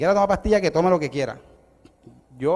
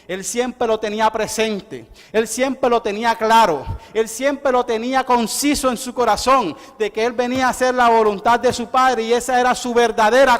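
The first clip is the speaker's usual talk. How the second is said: preaching build; 5 semitones higher, 5 dB louder than usual. Acted. Preacher stressing Christ's purpose, building preaching. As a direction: hammer "él siempre lo tenía" each time.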